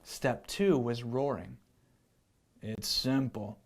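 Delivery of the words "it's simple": lowered lion teacher voice; a low, matter-of-fact lion. Acted. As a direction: speak it down low like a calm lion. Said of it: "said the lion".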